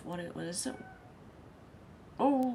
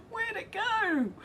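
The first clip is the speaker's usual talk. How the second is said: much higher, slow, through laughter; laughing disbelief; much slower than usual. Real, not acted. Host laughing at a confusing chat comment, slow and high. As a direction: break up laughing at the words.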